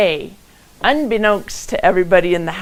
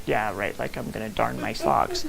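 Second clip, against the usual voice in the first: sarcastic; 9 semitones lower, 10 dB quieter than usual. Retold or imitated. Mocks her own plan to darn.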